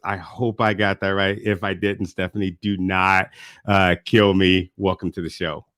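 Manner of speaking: ordinary delivery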